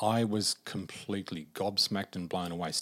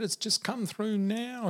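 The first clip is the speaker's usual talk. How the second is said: high, slow, overcome delivery; drawn-out words, pitched way up; imitated laughing and crying; 13 semitones higher, slower than usual. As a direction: act out emotional news down the phone. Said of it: "And she's laughing and crying".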